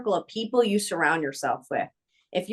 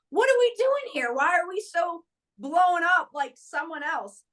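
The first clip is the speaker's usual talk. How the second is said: high-pitched, incredulous challenge; exasperated questioning; 8 semitones higher than usual. Retold or imitated. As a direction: question it in disbelief, pitch jumping up. Exasperated at starstruck colleague.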